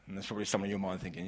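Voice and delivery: ordinary talk, nothing marked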